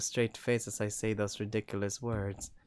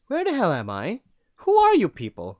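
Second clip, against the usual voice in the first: angry demand; 10 semitones higher, 12 dB louder than usual. Acted. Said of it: "I like the first one better".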